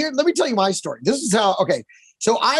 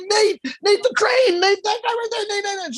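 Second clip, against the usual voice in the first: much higher, pleading the name over and over; insistent repeating; 10 semitones higher than usual. Retold or imitated. Scene: bettor telling confused cashier who Nate is, insistent. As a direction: hammer the name till she gets it. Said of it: "I'm like".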